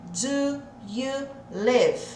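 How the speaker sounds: very slow, word by word with gaps; each word said apart, dragged out; separado, devagarzinho. Shown as a demonstration. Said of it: "Se você falar assim". (bem devagar, palavra por palavra)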